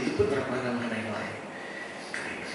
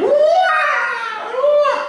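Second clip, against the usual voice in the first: very high, loud drawn-out wail; imitated crowd scream; 9 dB louder than usual. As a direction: imitate riders screaming on the drop. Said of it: "orang mulai teriak". (menirukan jeritan panjang melengking)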